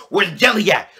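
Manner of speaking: high loud demanding bee voice; mock aggressive bee. Bee pressing the little kid for jelly, high loud demand. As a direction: get in the kid's face. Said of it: "He's like".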